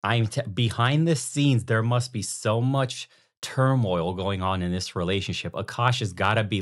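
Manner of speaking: normal talking voice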